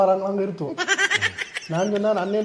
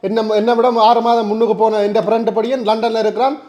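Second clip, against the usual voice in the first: loud, higher-pitched indignant protest; shouting; 4 semitones higher, 5 dB louder than usual. Acted. Younger man pleading for his village friend, shouting. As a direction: argue the friend's case at a raised, insistent volume. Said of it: "கத்தாத, கத்தினியாண்டா".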